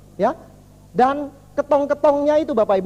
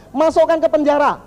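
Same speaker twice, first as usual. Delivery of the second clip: loud, raised royal command; angry king; 5 semitones higher, 5 dB louder than usual. Acted. King sending adviser to prison, angry. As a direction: order it as a furious king. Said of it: "rajanya marah".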